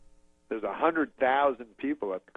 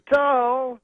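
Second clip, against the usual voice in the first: slower, drawn-out high shout; Bill Cosby; 12 semitones higher, 6 dB louder than usual. Retold or imitated. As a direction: stretch the syllables and break the word in two. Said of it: "he says it slower".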